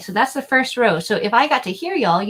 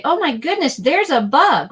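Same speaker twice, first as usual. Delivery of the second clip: startled cry, higher and louder; alarmed; 7 semitones higher, 7 dB louder than usual. Pretend. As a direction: gasp at a bug on you. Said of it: "The word we use when we wanna say".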